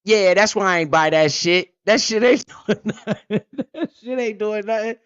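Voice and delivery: retelling a smug self through laughter; smug laughing. Retold or imitated. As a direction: gloat through a laugh at your own luck. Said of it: "I was like".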